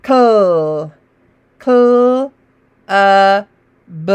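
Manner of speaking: "Cl" twice, "uh", "b" apart; separate sounds. Shown as a demonstration. Teacher sounding out letters. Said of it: "there's C L in the beginning".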